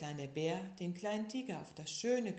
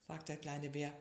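usual speaking voice

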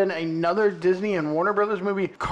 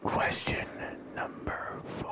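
whispering; 10 dB quieter, slower than usual. Acted. Whispered question announcement.

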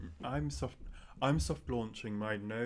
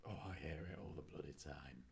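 whisper; 8 semitones lower, 13 dB quieter than usual. Real, not acted. Grumbling aside about hearing breathing.

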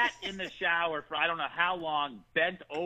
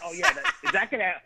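laughing; 9 semitones higher, 4 dB louder than usual. Retold or imitated. Laughing while high.

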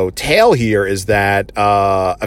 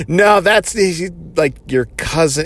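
a hint of a flustered Superman; awkward correction; 7 semitones higher than usual. Superman correcting Kara, retold.

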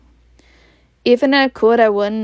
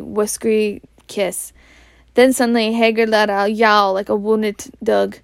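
ordinary delivery, nothing marked

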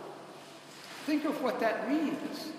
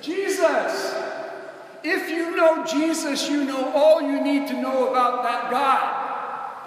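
high, loud and slow proclamation; full-force preaching shout; 6 semitones higher, 9 dB louder, slower than usual. Real, not acted. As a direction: drive the point home with full voice.

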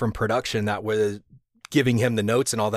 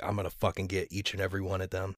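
mock vengeful mutter; 4 semitones lower, 11 dB quieter, faster than usual. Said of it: "he was like".

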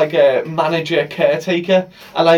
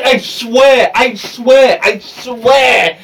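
"swear" louder and drawn out each time; insistent swearing; 3 semitones higher, 5 dB louder, much slower than usual. Real, not acted. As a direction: lean hard on every "swear". Insisting comically on a childhood belief.

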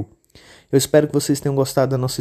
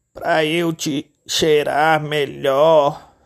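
slow, drawn-out sly wolf voice; wolf answering with false sweetness; wolf as grandma; 2 semitones higher, much slower than usual. Acted. Lobo disfarçado. (lobo disfarçado, fala arrastada)

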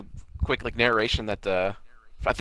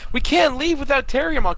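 high-pitched, louder alarm; acted-out alarm; urgent protest; 13 semitones higher, 5 dB louder than usual. Acts out urgent protest in story.

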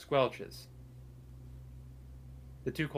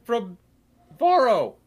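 much higher, louder squeaky cultist Jerry; 10 semitones higher, 12 dB louder, slower than usual. Acted. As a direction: pitch Jerry way up, loud and nasal-silly. DM voicing Jerry in dialogue.

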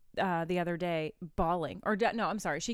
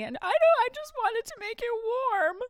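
put-on kid voice; 5 dB louder than usual.

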